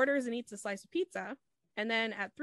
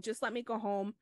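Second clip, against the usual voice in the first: ordinary talk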